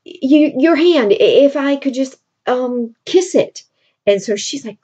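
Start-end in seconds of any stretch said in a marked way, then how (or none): 0.0-3.6 hesitant suitor. Retold or imitated.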